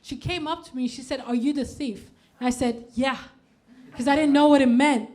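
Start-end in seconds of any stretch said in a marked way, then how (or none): none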